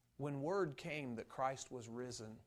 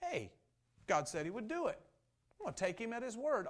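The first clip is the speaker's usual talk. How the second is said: higher, eager declaration of trust; excited conviction; 7 semitones higher than usual. Acted. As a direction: exclaim it like thrilling news. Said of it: "rather than".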